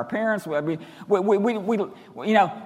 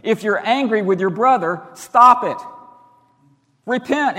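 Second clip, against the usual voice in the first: loud, raised preaching voice, punching the commands; emphatic command; 5 semitones higher, 7 dB louder than usual. Retold or imitated. Preacher voicing Jesus's command against anger, emphatic. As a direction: lay down the command forcefully, higher and louder. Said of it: "he's so emphatic about it".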